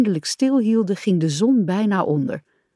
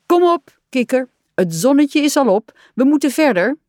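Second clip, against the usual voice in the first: high, loud, brisk wake-up; rally a sleepy friend; cheerily urging, pitched up and louder; rousing call; 7 semitones higher, 5 dB louder than usual. A narrator's reading. Rat rousing sleeping Frog.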